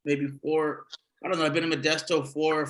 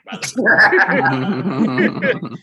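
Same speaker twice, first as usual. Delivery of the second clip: laughing aside; 4 semitones higher, 9 dB louder, much slower than usual. Real, not acted.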